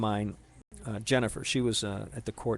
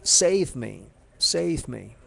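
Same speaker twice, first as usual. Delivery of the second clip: a loud mock cry for help, falling on save; retold yell; 2 semitones higher, 6 dB louder, much slower than usual. Retelling the crash, he yells his plea for rescue. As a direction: call out save me, half-shouted as he remembers it. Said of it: "you yelled out".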